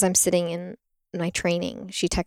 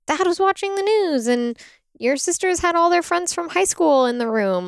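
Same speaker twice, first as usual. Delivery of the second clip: higher-pitched mock mom voice; imitating mom; 10 semitones higher than usual. Daughter mimics her mom recalling the birth, higher voice. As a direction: put on mom's higher complaining tone. Mom's complaint retold in quote.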